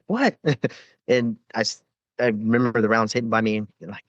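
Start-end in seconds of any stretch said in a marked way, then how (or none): 0.0-0.4 sudden high what; jolted reply, pitched up sharply; startled answer. Retold or imitated. Medic answering Marines' call, startled high reply.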